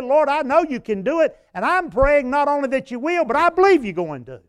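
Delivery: imitating a man begging God aloud; begging prayer. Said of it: "He was begging God and said".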